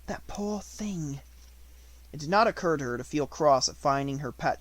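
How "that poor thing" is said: slow, soft and pitying; tender pity. Acted. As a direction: breathe it out with pity. Sarah pities sleeping Becky.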